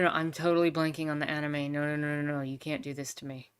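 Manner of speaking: ordinary talk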